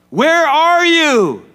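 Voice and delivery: high, slow, loud call of God. Retold or imitated. God calls to the man in the garden, loud and drawn out.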